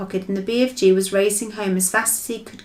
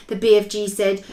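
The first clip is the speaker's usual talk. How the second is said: ordinary delivery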